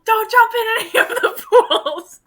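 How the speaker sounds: high, loud yell breaking into a laugh; shouted in-character warning, laughing partway. Pretend. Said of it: "I'll just yell".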